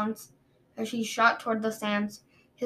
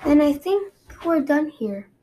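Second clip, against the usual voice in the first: cold queen dismissal; 5 semitones higher, 6 dB louder than usual. Acted.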